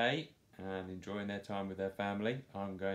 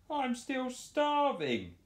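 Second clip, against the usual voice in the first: much higher, louder, slow wail; weeping; 5 dB louder, slower than usual. Acted. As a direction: weep in childish hunger. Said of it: "wept Morris".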